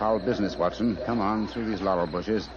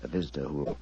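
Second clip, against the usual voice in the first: hushed; 6 semitones lower, 8 dB quieter, faster than usual. Acted. Whispering plan while waiting.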